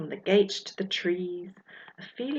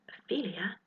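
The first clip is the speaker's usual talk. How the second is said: hushed whisper close up; 7 dB quieter than usual. Acted. Albert quietly calling to Ophelia nearby, whispered. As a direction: breathe her name softly. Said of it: "Albert says close".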